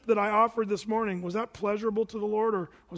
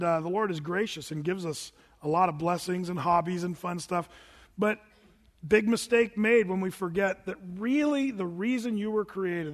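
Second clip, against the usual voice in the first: ordinary delivery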